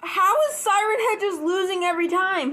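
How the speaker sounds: high, incredulous outcry; can't believe what you just saw; disbelief. Real, not acted. Disbelief at the video.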